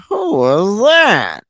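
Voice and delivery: high, slow, odd voice of a strange guy; mock weird high voice, slow; Harold's weird voice.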